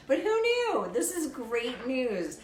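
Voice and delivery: loud, high whoop of delight; over-the-top celebratory holler; mock jubilation. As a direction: cry it out high and drawn out. Mock celebration of her deep voice.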